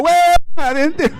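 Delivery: high, long, loud holler; drawn-out Puerto Rican cheer; celebratory whoop. Acted. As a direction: belt out a huge whoop.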